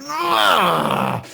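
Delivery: loud, high imitated roar of rage; enraged growl. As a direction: roar out a frustrated "ugh". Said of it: "And then he gets mad".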